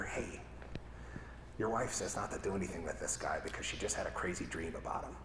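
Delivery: low, very soft, confidential murmur; hushed minion whisper. Acted. Minion delivering a wife's message to Pilate, hushed. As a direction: slip it discreetly into the boss's ear. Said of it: "whispers in your ear".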